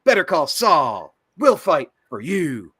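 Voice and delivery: slow, punchy ad-man read; hammed-up TV lawyer spot; cheesy commercial pitch. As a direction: sell it like a late-night lawyer ad. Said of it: "one of those cheesy commercials".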